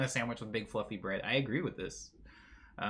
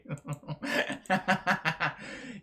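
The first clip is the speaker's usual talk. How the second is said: breathy laugh. Real, not acted. Laughs at own joke.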